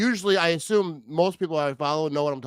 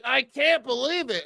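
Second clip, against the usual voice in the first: mock shock; 8 semitones higher, slower than usual.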